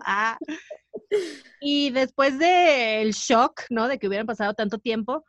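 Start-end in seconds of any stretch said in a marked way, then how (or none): none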